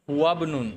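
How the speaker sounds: হুয়াবনুন run together as one word; hamza skipped. Shown as a demonstration. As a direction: link হুয়া straight into bnun with no i sound. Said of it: "তখন আমরা এইভাবে বলি".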